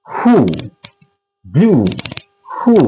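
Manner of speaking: হু rhymed with ব্লু, each word separate and drawn out; rhyming demo. Shown as a demonstration. Teacher matches who to blue, careful rhyming demo. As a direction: model হু with the oo of ব্লু. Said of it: "ঠিক ওই ইউ এর মতো pronounce হবে".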